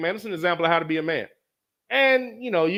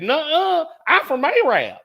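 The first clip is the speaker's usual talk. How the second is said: higher, louder sassy woman's retort; mock indignant woman; 10 semitones higher, 4 dB louder, slower than usual. Woman in the stands snapping back at Pop, mock indignant. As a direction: throw it back at him like an offended fan.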